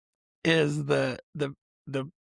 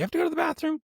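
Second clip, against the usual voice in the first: much higher put-on voice; silly squeaky voice; 13 semitones higher, much faster than usual. Host asking cohost in a high silly voice. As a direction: squeak the question playfully.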